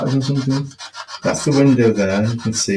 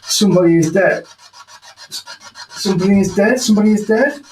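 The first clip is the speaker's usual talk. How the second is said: rising alarm; 7 semitones higher than usual. Real, not acted. Repeating the spirit's message.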